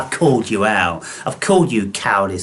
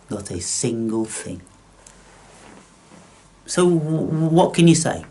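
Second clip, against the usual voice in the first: ordinary delivery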